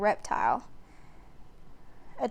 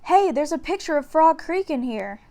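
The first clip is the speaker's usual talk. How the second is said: put-on surprised boy; 5 semitones higher, 4 dB louder than usual. A narrator's reading. Jack surprised.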